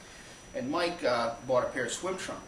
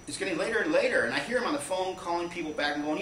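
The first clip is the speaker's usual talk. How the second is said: plain reading voice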